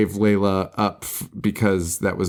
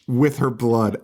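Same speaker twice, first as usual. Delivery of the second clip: normal talking voice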